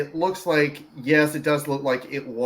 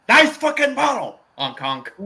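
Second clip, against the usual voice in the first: Beetlejuice impression; 10 semitones higher, 5 dB louder than usual. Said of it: "how about this one".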